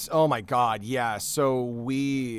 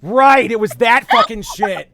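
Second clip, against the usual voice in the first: high, loud ranting fury; real heated rant about a film romance; nerd rage; 5 semitones higher, 5 dB louder than usual. Real, not acted. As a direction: rant it like a furious fan. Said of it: "like, an actual, like, nerd rage".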